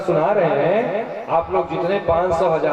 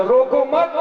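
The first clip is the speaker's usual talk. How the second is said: रोको मत run together, मत pitched up at the end; misheard phrasing; 5 semitones higher than usual. Acted. Boy understanding don't stop him, मत joined to रोको. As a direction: join मत straight onto रोको, rising. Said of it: "लइका समझ गया".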